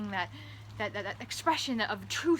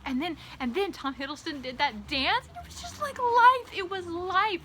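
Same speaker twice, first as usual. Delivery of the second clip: giddy gushing; 7 semitones higher than usual. Retold or imitated. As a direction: squeal it out. Fangirl gushing.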